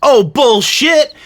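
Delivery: loud slow scoff; scoffing shout. Acted. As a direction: call out his lie. Calling out a lie.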